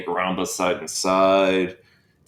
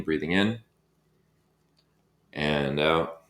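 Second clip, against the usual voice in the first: normal talking voice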